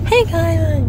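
vlogger greeting. Retold or imitated. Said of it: "I did not wanna be like".

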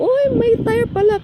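surprised. Retold or imitated. Noticing the tire on the car.

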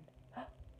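sharp breathless gasp of discovery. A narrator's reading. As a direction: suck in a quick gasp. Finds the sleeping bag.

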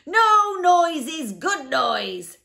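high, very loud, slow crow; happy exclamation. A narrator's reading. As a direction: belt out the punchline gleefully. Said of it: "exclaimed the humbug, happily".

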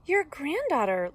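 put-on lilting girl announcing herself, without squeaking; lightly voiced Little Red. A narrator's reading. Little Red answering at Granny's door, lifted girlish voice.

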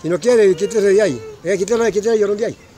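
loud, high calling out; shouting. Real, not acted. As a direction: bark orders to move aside. Ordering someone out of photo.

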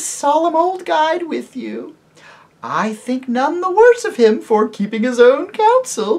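high, loud, slow and sweet lady's voice; lady tempting the children toward Harfang; enchanting lady. Acted. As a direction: lure them with honeyed warmth. Lady character voice.

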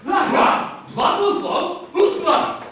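angry retort. Acted. Councillor shouts back in anger.

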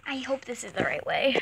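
hushed nervous whisper. Real, not acted. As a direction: breathe it out, worried. Whispering mid-round on horse.

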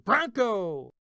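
loud startled cry; shouting. Retold or imitated. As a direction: yell it jumping up.

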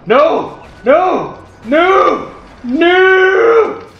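despairing no. Real, not acted.

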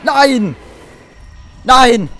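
high loud shriek; terrified scream. Real, not acted. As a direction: scream in despair. Attacked again.

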